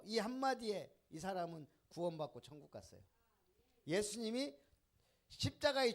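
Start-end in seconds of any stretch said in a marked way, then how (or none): none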